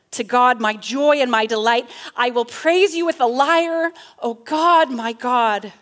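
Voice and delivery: loud, exultant declaration; breakthrough praise. Acted. Psalmist breaking through to praise God, exultant. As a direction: let the praise ring out loudly. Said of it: "the praise, the resolution breaks through".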